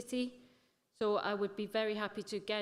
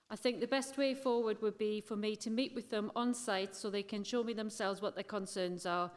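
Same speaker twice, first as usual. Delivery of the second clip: usual speaking voice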